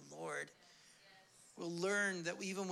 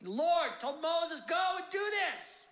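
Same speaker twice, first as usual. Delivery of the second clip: full-force preaching; 10 semitones higher, 5 dB louder than usual. Acted. The Lord sends Moses to Pharaoh, proclaiming. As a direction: declare it as a divine command.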